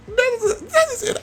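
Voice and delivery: very high squeak on the name; squeaky high voice. Acted. Voicing the stoned sim in a very high squeak. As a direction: squeak it out like a cartoon.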